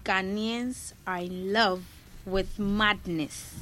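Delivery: slow, deliberate repeat, every word spelled out; slowed-down repeat for a stunned listener; slow clear repeat. Real, not acted. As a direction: say it again slowly so it lands. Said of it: "He said what?".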